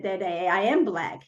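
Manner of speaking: ordinary talk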